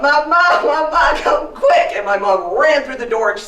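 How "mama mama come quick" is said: put-on high, loud child calling for mama; panicked yell. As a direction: yell out for help from bed. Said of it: "And I yelled".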